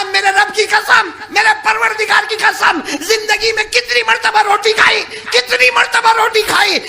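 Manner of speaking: high, loud ecstatic swearing; excited oath. Acted. The companion swearing to the Prophet, high, loud and overjoyed. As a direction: cry out the oath with overflowing joy.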